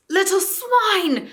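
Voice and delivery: very high, loud, slow furious shriek. A narrator's reading. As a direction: shriek in rage at the dwarfs. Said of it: "shrieked Eustace".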